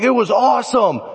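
high-pitched, gushing retelling; excited boast. Retold or imitated. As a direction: gush about the catch to your uncle. Retells his excitement to uncle.

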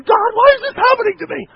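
high, loud cry of frustration at God; exasperated shout. Retold or imitated. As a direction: yell it up at the sky, fed up. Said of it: "And I'm going".

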